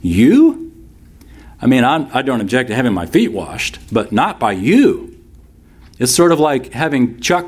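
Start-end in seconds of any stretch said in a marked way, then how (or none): 0.0-0.7 YOU leaping up in disbelief; a single emphatic, questioning YOU; incredulous stress. Acted. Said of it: "That's the emphasis". 3.9-5.2 slow objection, YOU swooping upward; stress on YOU. Acted. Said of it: "That's the emphasis".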